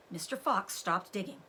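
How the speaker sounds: ordinary delivery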